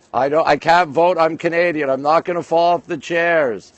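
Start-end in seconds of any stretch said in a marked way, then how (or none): none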